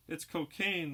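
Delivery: starting to panic; rising alarm. Acted. Red Cross girl discovering the cocaine, alarm rising. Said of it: "the girl's voice rising to an alarming intensity".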